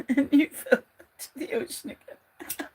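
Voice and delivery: words through laughter; laughing. Real, not acted. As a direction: laugh while saying it. Laughing at game.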